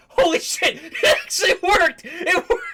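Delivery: very high, loud elated squeal; acted joy bursting into laughter; Jinx's genuine joy. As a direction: shriek it in delighted disbelief. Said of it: "like, genuine joy that it actually worked".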